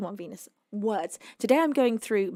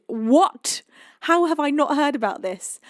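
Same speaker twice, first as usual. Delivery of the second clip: real loud, higher-pitched disbelief; astonished; 5 semitones higher, 7 dB louder than usual. Real, not acted. Streamer reads that the film cast returns, astonished. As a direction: burst out in surprise at news you missed.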